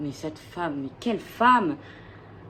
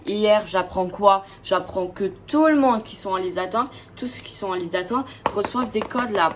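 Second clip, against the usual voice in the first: frustrated rant; 3 semitones higher, 6 dB louder than usual. Real, not acted. Ranting about missing Eras Tour codes.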